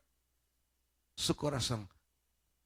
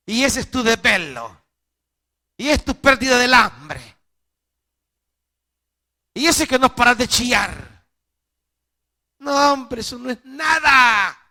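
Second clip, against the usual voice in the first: louder and higher, building to a shout; rising shout; 6 semitones higher, 7 dB louder than usual. Real, not acted. Preacher shouting down his listener's fears, louder and higher. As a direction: brush the fear off with a shout.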